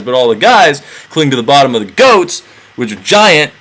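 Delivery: usual speaking voice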